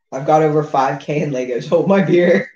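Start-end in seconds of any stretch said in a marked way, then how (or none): none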